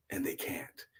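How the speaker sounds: a soft whispered drop. A narrator's reading. Angel explaining the starving room, whispered. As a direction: hush it at the end.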